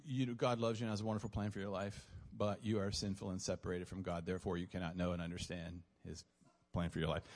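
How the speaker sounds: low, matter-of-fact recital of a script; reciting a pitch. Retold or imitated. Retold canned four-point gospel pitch.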